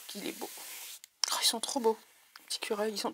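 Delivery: souffle émerveillé à voix basse; chuchoté. Real, not acted. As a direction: admirer tout bas. Admiring drawings softly. (chuchote d'émerveillement)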